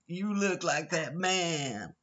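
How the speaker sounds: high, slow, insistent mock American; mock American tourist. Imitating tourists insisting he is famous, drawn-out mock American accent. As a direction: stretch the words in a high American voice. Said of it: "And they go".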